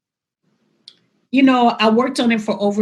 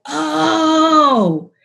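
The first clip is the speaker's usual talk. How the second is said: realisation; 7 semitones higher, 5 dB louder than usual. Retold or imitated. Sudden realisation retold.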